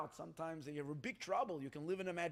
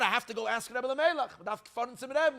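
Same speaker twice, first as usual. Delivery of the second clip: insistent villager; 6 semitones higher, 7 dB louder than usual. Retold or imitated. Villager quoted, wants the Rebbe.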